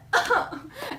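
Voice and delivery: light high laugh; high giggle. Real, not acted. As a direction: giggle at your own joke. Laughing at own story.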